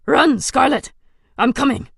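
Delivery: rushing to help, calling out; frantic comrade yelling; urgent shout. Acted. Ally shouting a warning.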